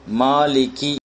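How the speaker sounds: slow "மாலிகி" with long vowels; measured recitation of "மாலிகி" with a clear k; kaf example word. Shown as a demonstration. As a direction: stretch out "மாலிகி" syllable by syllable. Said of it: "அதுல வரக்கூடிய காஃப்".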